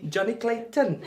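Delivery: high-pitched comic Welsh; mock Welsh accent. Streamer taking the mick, mock Welsh accent. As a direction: say the name in cartoonish Welsh. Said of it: "That's just taking the mick".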